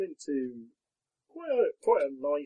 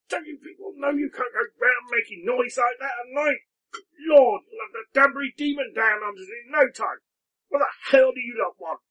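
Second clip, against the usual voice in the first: loud, high, furious scolding at the door; a roused farmer yelling at strangers at night, furious; angry old man; 10 semitones higher, 8 dB louder than usual. Acted. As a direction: bellow at the night visitors.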